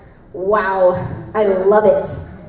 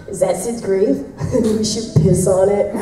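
laughing quote; 3 semitones lower than usual. Retold or imitated. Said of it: "he goes up to the grave and he's like".

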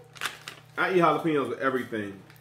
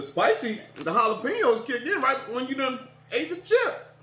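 laughing; 9 semitones higher than usual. Real, not acted. Laughing at the heat.

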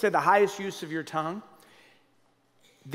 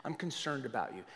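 gentle concern; 6 semitones lower, 9 dB quieter than usual. Shown as a demonstration. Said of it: "we can't say to somebody".

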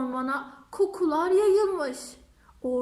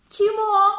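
fox calls out; 4 semitones higher, 8 dB louder than usual. Acted.

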